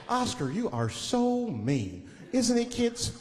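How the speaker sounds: put-on slow, patronizing children's-show host; judging show host. Show character judging Oscar before kids, slow patronizing voice. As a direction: scold slowly, sweetly for the kids. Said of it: "They judge him right in his face".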